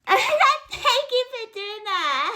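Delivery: high, drawn-out fangirl squeal; mock gushing squeal. Woman thanking him for the dumb cheer, mock gushing. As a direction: gush it slowly, way up high.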